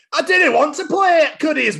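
high Scottish Susie. Acted. Reading Peppa book aloud, high Scots Susie. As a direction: whine it like a wee Scots girl. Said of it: "I'm gonna do it in my accent".

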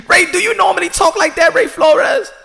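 loud, high-pitched disbelief; mock incredulous. Asking Ray if he normally talks like that, incredulous.

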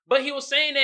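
ordinary talk

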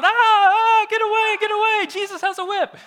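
high, loud hammed-up cries of alarm; mock panic. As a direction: play the terrified merchants fleeing in comic panic. Acting out merchants fleeing the whip.

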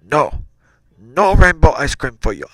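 lightly hammed-up father refusing; mock-stern, exaggerated dad; terrible dad voice. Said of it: "this, this terrible voice".

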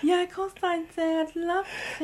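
high, soft breathless yes; mock eager Daisy. Daisy to Ryan, high soft eager acceptance. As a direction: jump at it too eagerly.

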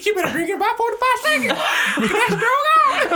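very high, fast put-on squeal; mock excitement. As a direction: shout it like a giddy mill hand.

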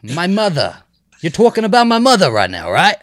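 loud, high, exaggerated New Jersey accent; a mock-offended Jersey mom voice; hammed-up New Jersey accent. Shown as a demonstration. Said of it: "that's how I talk".

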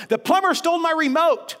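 put-on high, loud, upset old mother's wail; shrill-free high mock panic of an old mom; agitated elderly mother. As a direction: play the frantic mother complaining. Agitated mother voiced again.

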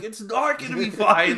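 high, loud half-laughing protest; hammed-up freaking out; mock panic. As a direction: play up your own panic, laughing at it. Retelling own panic to surgeon.

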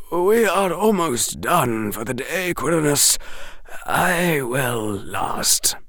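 put-on elderly headmaster gently insisting; Dumbledore. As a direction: reassure quietly, slowing at the end.

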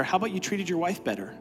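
ordinary talk, nothing marked

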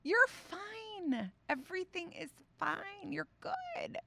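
high, soft, lilting "You're fine"; sing-song reassurance. Shown as a demonstration. Mom soothing her daughter's bruise, high and hushed. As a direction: coo it like soothing a small child. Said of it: "I said it exactly like that".

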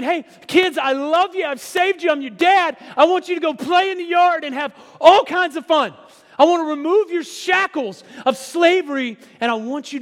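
high, loud, exultant proclamation; joyful God. Pretend. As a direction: shout God's love out to his kids. God speaking to his children.

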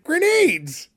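high-pitched comic character cry; high excited character voice; Fester impression. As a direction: announce it in a high, gleeful cartoonish voice. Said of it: "You did a, a spot-on impression".

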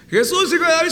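high, loud pleading cry. Retold or imitated. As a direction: yell it over everyone. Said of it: "empezó a gritar más fuerte". (grito más fuerte y agudo)

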